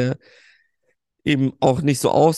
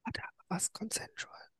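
hushed whisper; 7 semitones higher, 19 dB quieter than usual. Real, not acted. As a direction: whisper so no one wakes up.

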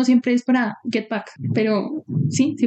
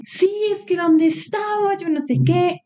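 mock angry boyfriend; 7 semitones higher, slower than usual. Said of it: "Y el man emputado como".

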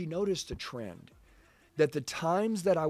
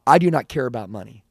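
much louder, forceful retold declaration; emphatic insistence; 13 dB louder than usual. Wife telling husband her priorities, loud insistence. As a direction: drive it home hard, acting out the quote.

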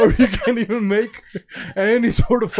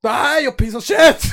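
higher and louder, slow hammed-up yell; mock furious shout; 6 semitones higher, 6 dB louder than usual. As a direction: bellow the insult like a raging dictator. Acted tirade at shamed man.

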